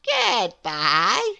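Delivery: bright smiling contrary goodbye; smiling Miss Contrary. Acted. Miss Contrary greets Mister Small, smiling goodbye. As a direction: smile as you say goodbye. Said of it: "smiled little Miss Contrary".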